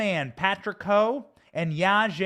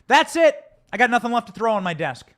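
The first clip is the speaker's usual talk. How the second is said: loud, furious, at the end of his rope; angry outburst; 3 semitones higher, 7 dB louder than usual. Real, not acted. Host fuming on air about the text, loud angry outburst. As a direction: blow up after throwing everything off the desk. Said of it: "I'm sick of it".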